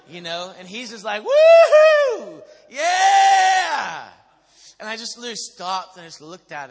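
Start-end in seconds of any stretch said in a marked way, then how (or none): 1.2-4.1 long, high, shouted whoop; imitated ecstatic cheering. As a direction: cheer wildly at full volume. Imitates worship leader cheering again.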